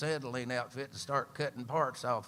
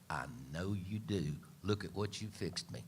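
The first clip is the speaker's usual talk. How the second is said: a hint of a contented husband, lower and softer; lightly voiced husband, tender; 5 semitones lower, 7 dB quieter than usual. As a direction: murmur it like a pleased old boy to his wife. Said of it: "And he'd say".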